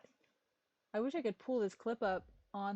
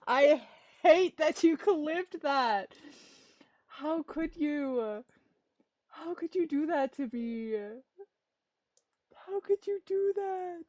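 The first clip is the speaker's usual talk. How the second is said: high, loud hammed-up wail; mock crying; 8 semitones higher, 10 dB louder, slower than usual. Streamer mock-wailing at chat for clipping her. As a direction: overplay the betrayal, whining. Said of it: "I'm gonna start crying now".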